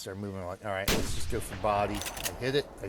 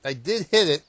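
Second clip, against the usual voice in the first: higher and louder, imitating the surprise; surprised realization; 9 semitones higher, 7 dB louder than usual. Gamer retelling finding the bear hit, surprised. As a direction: replay that sudden oh, look at that. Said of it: "Then I realized".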